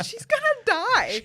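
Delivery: high, laughing she's doomed; a viewer watching the frail old lady order drinks, laughing; laughing dread. Retold or imitated. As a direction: laugh it out in mock horror. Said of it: "you're just like".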